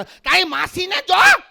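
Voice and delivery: shrieking, drawn-out Saurashtra scolding; hammed-up Kathiyawadi shout; fearsome Kathiyawadi woman. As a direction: yell high and slow like a Kathiyawadi woman. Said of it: "ખતરનાકમાં ખતરનાક બાઈ હોય તો સૌરાષ્ટ્ર".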